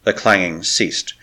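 plain reading voice

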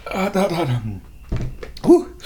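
high, breathy hot hot hot; burnt mouth. Real, not acted. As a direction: blow out the heat after a scalding sip. Scalded by the tea.

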